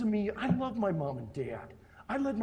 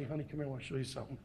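soft fast invitation; 4 semitones lower, 8 dB quieter, much faster than usual. Pretend. Invites spouse to see place.

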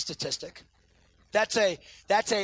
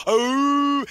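short mock howl, a bit higher and louder; imitating a howl; 6 semitones higher, 7 dB louder than usual. Son starting the duet again, short mock howl. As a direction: toss off a quick husky howl. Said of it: "He'll do the exact same tone".

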